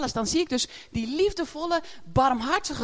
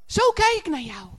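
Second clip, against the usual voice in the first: tender voice of God; 6 semitones higher, slower than usual. Acted. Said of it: "dat God dus zegt".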